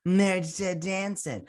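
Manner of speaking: high, slow put-on Whoopi voice; Whoopi impression. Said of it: "they should get Whoopi in here".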